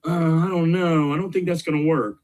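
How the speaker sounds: a hint of a whiny pessimist; whiny doubter. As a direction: imitate the gloomy teammate. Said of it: "in my group going".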